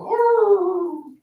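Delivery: high yelping dog howl. Retold or imitated. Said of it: "he just make this little howl".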